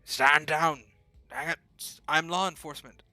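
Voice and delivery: loud, desperate roaring plea; hoarse roar. A narrator's reading. Duncan roars at the police to stop firing, hoarse. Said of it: "His voice comes out in a hoarse roar".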